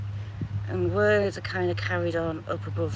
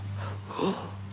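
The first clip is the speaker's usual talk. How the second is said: whispered gasp; 10 dB quieter than usual. Real, not acted. Gasping in shock.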